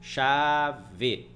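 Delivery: "Chave" broken slowly into cha-ve; drawn-out "Chave" as cha... ve; syllable division. Shown as a demonstration. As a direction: say "Chave" in two long syllables, full final e. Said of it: "Na mesma sílaba".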